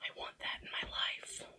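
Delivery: wistful whisper. Real, not acted. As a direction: whisper it like a secret wish. Wistful aside about glitter filter.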